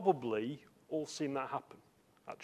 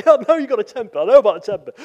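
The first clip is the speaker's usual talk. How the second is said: loud, higher, exasperated comeback; fed-up shout at a repeat offender; angry retort; 6 semitones higher, 16 dB louder, much faster than usual. Retold or imitated. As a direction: snap back at the brother who keeps hitting you. Bill angry at repeat punch.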